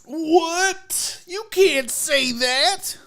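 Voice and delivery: high, drawn-out mock outrage. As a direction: gasp it out as a scandalized listener.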